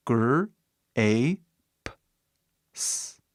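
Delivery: Gr-, A, P, S sounded out one by one; spelling letters. Shown as a demonstration. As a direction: break grapes into its letters. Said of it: "fala seu nome".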